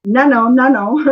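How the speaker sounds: a hammed-up protest, tossing off each "no"; mock no no no. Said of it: "I feel like going".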